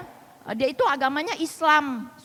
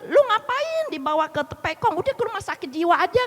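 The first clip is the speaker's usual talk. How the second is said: higher-pitched mock reproach from a sister; retold scolding; 6 semitones higher than usual. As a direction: lightly imitate an exasperated sister. Sister scolds over temple visit.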